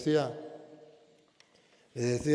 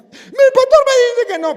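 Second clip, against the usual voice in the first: much higher and louder woman's voice; insistent mother; 17 dB louder than usual. Retold or imitated. The mother answers.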